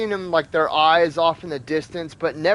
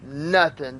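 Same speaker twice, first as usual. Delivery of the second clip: plain reading voice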